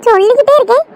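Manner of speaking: loud, heated sibling squabble; sisters quarrelling. Acted. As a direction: shout and taunt your little sister. Two sisters quarrel loudly. (தங்கையுடன் சண்டையிடும் உரத்த கோபக் குரல்)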